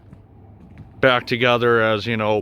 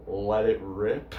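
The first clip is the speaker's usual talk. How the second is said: lightly imitating a brother, words drawn out; brother's catchphrase; 4 semitones lower, 9 dB quieter, slower than usual. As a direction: stretch it slowly, like quoting his favorite saying. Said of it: "this is how he would always say it".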